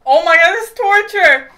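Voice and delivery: high, loud cry of disgust; disgusted shout. Real, not acted. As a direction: wail in disgust. Reacting to a disgusting taste.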